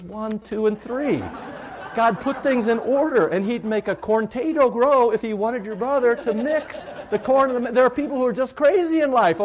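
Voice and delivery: high, loud comic tirade from the pulpit; mock-outraged preaching. Pastor to congregation about food mixing, mock-outraged. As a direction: preach it hard, as if it were a grave sin.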